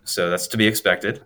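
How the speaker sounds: put-on a light German accent, dry and matter-of-fact; a hint of German accent from the surgeon. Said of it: "this German guy with a German accent".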